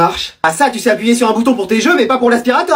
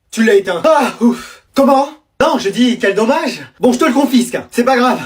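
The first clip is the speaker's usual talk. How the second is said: ordinary talk, nothing marked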